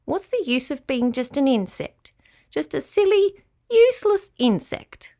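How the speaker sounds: high, self-pitying small voice; rising, high-pitched despairing grumble; sulky little cricket. Acted. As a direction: complain as a dejected tiny cricket. Cricket sulks over being an insect.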